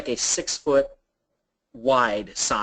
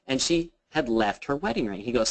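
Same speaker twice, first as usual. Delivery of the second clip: usual speaking voice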